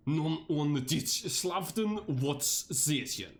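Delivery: slow, careful German recitation; amateur reading a German text, unhurried; mock German poem reading. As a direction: drag each German word out as if reciting a poem. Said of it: "Time to do the German".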